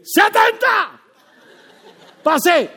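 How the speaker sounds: shouted high and loud, exultant; triumphant shout. Retold or imitated. Preacher recalls getting seventy on his driving test: triumphant shout. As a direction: belt it out like you just won. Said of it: "y yo dije".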